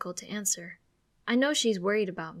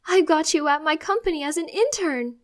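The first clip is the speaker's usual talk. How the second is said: high and loud, gushing; thrilled announcement; 8 semitones higher, 9 dB louder than usual. Acted. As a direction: announce the news like a gift. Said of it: "she beams with excitement".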